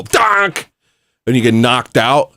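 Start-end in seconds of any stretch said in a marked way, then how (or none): 0.0-0.7 high-pitched comic "donk"; imitated collision bonk. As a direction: mimic a head hitting metal, cartoon-style. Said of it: "And then you go".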